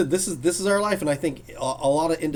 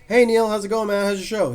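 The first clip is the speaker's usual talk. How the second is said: loud, higher friendly hail; calling out; 8 semitones higher, 6 dB louder than usual. Retold or imitated. Fan hails Neil across the convention floor, loud call. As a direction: call across the hall to him.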